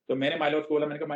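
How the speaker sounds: usual speaking voice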